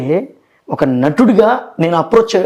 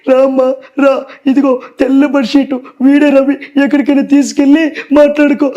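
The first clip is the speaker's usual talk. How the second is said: film character impression; 7 semitones higher than usual. Shown as a demonstration. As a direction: bark the command like a film heavy. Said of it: "ఒకే లైన్లో బిట్లో ఉంటాయి".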